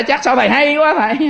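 high-pitched astonished follower; mock amazement. Follower marvelling that the monk knew, mock surprise.

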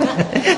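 real laugh, high; a monk laughing at a confession of missing someone; high burst of laughter. Real, not acted. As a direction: laugh out at the admission. Amused at the student's confession.

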